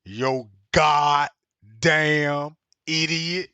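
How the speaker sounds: low, very slow punchline; deliberate mock insult, word by word; drawn-out quoted insult. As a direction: drop the pitch and stretch each word.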